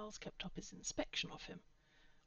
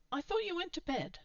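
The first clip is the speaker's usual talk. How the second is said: put-on higher, louder girl's line; lightly voiced girl, higher pitch; teen girl's voice; 11 semitones higher, 5 dB louder than usual. A narrator's reading. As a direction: raise the voice for the girl.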